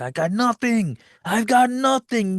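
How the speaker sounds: high-pitched wail of a man wiped out; mock despair. Character finds everything wiped out.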